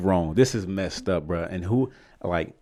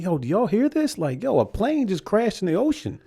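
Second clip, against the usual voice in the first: high-pitched, incredulous appeal; retold alarm; 11 semitones higher than usual. Storyteller to fellow passengers after crash news, retold alarm. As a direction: ask it in mock panic, pitched up.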